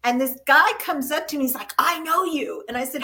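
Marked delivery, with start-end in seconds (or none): none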